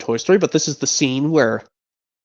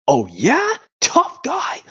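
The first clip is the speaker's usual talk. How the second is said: mocking taunt; 11 semitones higher, slower than usual. Mocking retort in the quarrel.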